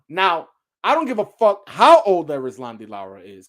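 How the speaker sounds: ordinary talk, nothing marked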